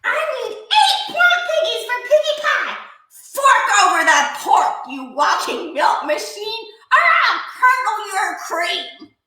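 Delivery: threatening witch. A narrator's reading. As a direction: hurl the threat at the cow.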